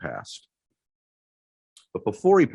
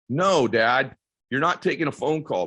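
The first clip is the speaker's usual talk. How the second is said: louder, higher, insistent; firm refusal; 7 semitones higher, 3 dB louder than usual. Retold or imitated. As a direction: stop him firmly. Son refusing father.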